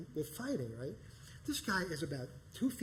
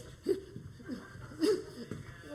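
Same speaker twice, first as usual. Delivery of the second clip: high, silly kung-fu squeals through laughter; mocking the fake karate moves; mock karate noises; 13 semitones higher than usual. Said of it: "he's doing this".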